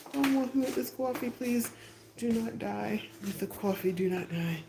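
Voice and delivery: high, pleading squeal; anxious pleading. Real, not acted. Pleading with her brimming coffee cup, high and anxious. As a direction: beg the coffee not to spill.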